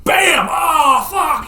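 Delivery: high, loud burst of alarm; shouted re-enactment. Acted. Storyteller acting out the sniper hit, shouted. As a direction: act out the gunshot and the shock, shouting.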